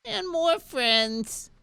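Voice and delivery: high, very slow, drawn-out dragon squeal; the settled baby dragon voice. Acted. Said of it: "Oh, that's it".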